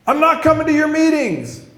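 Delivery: high sustained shout of refusal; exasperated yell. Retold or imitated. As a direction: yell it to drive off a pest. Yelling at the pastor.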